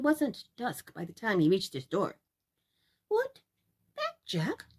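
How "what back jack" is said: much higher startled cry; surprised mother. Acted. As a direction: catch her off guard. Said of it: "said his mother".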